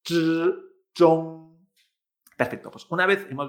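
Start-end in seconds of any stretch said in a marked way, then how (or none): 0.0-0.5 "Zh" held as a buzz, tongue raised; zh with throat vibration. Shown as a demonstration. Teacher isolating the sound for students, "Zh" buzzed with tongue up. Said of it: "esta vibración en la garganta". 1.0-1.7 loud, level ZHONG with a buzzed zh; zh with throat vibration. Shown as a demonstration. Teacher modelling the whole word for students, zhong with vibrating zh. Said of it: "esta vibración en la garganta".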